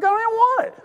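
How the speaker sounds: high, squeaky disbelief; mock high-pitched protest; baffled refusal. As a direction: squeak it out, bewildered. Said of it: "I'm like".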